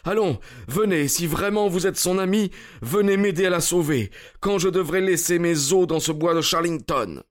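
high, agitated urging; desperate plea. A narrator's reading. Stranger begging Holmes to help save her, desperate plea. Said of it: "au comble du désespoir".

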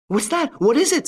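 jolted by the crash, blurting in alarm; alarmed stammer. A narrator's reading. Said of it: "stammered the widow ... in alarm".

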